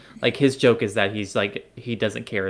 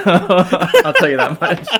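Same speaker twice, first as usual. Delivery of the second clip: higher, louder, slow, laughing; laughing admission; 7 semitones higher, 11 dB louder, slower than usual. Real, not acted. Admitting a crush on the prince, laughing. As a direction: giggle the confession out.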